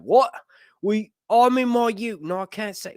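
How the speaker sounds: high, mock-indignant Australian voice; badly faked Australian protest; botched Australian accent. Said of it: "bunch of Australians out there like".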